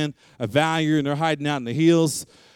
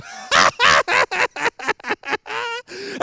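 imitated belly laugh. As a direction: laugh hard like a giggling little girl. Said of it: "she's got a belly laugh".

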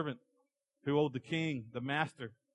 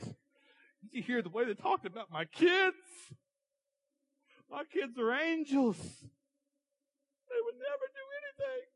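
exaggerated high falsetto grievance, drawn out; mock offended parent; slower than usual. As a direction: climb into a tiny, wounded squeak. Mock offended parent holding a grudge.

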